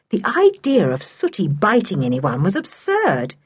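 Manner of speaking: usual speaking voice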